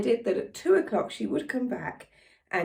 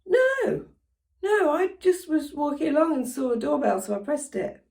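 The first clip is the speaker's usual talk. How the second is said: high-pitched mock American woman; light American accent, explaining higher; imitated American accent; 6 semitones higher than usual. Caller explaining the doorbell, imitated American accent. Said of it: "again, in an American accent".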